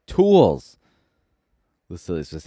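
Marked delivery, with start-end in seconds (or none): 0.1-0.8 sudden high, loud shout; yelling. A narrator's reading. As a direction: blurt it out in a desperate yell.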